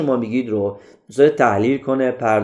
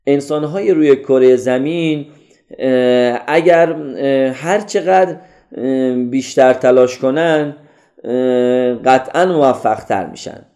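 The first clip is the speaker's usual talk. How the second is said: hesitant delivery broken by drawn-out fillers; تپق با «اه»; slower than usual. Shown as a demonstration. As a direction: stall with long filler sounds between phrases. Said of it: "«اه»، مکث کنید". (با «اه»های کشیده بین کلمات)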